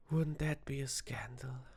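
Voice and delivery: gasp, then a whispered mock-shocked tease; mock scandal whisper. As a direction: play at being scandalized, voice breathed out. Teasing the guest's fear.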